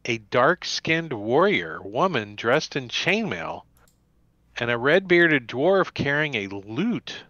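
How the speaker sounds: ordinary delivery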